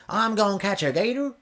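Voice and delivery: high-pitched mock Southern accent; playful high Southern impression. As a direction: put on a Southern US twang, pitched well up. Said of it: "I think that's the South accent".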